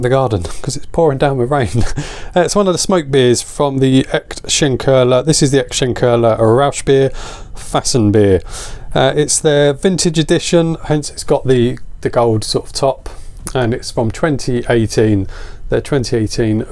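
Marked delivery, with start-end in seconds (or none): none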